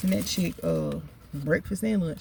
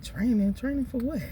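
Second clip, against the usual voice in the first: puzzled; 6 semitones higher than usual. Retold or imitated. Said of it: "I'm like".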